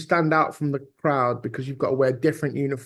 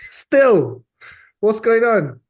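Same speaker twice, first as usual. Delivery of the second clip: high, loud laughing exclamation; laughing disbelief; 8 semitones higher, 8 dB louder, much slower than usual. Real, not acted. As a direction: cry out through a laugh. Laughing disbelief at racial profiling.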